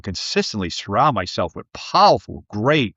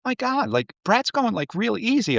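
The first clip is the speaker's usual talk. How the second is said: high mock whisper; 12 semitones higher than usual. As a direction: squeak it out like a shocked aside. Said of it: "she goes".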